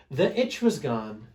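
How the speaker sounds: usual speaking voice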